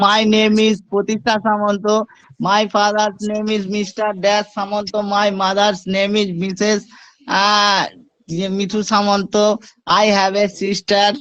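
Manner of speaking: put-on slow, sing-song rote recitation like a child reading a memorised script; rote kids' recital. Shown as a demonstration. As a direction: recite it word by word, as a schoolchild would. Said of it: "ওটা তো বাচ্চাদের জন্য লিখে দিয়েছি".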